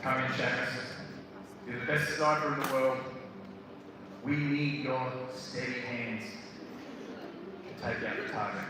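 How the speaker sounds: low, measured commander on the radio; military radio voice. Acted. Commander radioing his sniper about the target, low military voice. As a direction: call it in low, like mission control.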